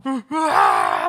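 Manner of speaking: loud, drawn-out panicked yell; acted disciples' shriek of fright; startled scream. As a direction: scream like you've seen a ghost. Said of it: "막 이러고 있는데".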